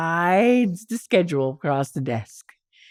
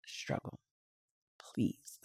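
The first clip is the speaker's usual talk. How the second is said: whispered, broken plea; begging in tears; 14 dB quieter, slower than usual. Retold or imitated. Begging Struggle for a job, tearful whisper. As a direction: plead in a breaking whisper. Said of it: "I'm bawling. I'm crying".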